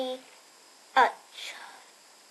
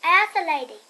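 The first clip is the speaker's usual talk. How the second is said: plain reading voice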